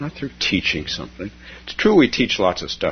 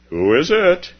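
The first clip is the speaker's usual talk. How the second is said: high, louder "who is it"; calling through the door; 9 semitones higher, 6 dB louder than usual. Acted. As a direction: call out from inside. Beloved asks through door.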